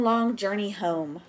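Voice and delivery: plain reading voice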